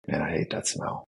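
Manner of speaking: imitating someone low and fed up; annoyed grumble. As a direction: mutter it down low like you hate that smell. Voicing a more aware annoyed person.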